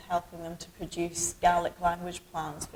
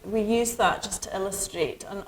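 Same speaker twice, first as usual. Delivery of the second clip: ordinary talk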